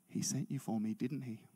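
low, soft, dawning question; hushed realisation. Retold or imitated. Moved seatmate leaning in to ask the preacher, hushed. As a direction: lean in and ask it low and quietly. Seatmate leans in after silence.

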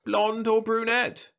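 put-on high, slow, non-committal old lady. A narrator's reading. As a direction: ask it without caring. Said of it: "she asked non-committally".

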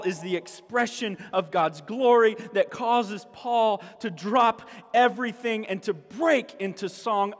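ordinary talk, nothing marked

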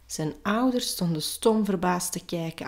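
ordinary delivery

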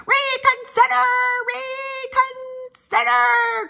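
high, loud, stretched goose cry; long piercing honked plea; goose's cry. A narrator's reading. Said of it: "cried the goose".

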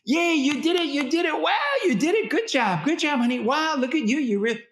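very high, bubbly cheering; praising a happy child. Shown as a demonstration. Parent cheering a happy toddler, very high and bubbly. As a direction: gush over the child's success. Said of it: "that's exactly what you do".

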